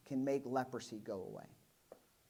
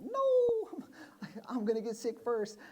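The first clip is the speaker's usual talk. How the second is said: high-pitched squealing panic, half laughing; high comic yelp of panic, laughing; high mock squeal; 8 semitones higher, slower than usual. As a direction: squeal like a scared kid fleeing. Disciple fleeing, voiced squeal.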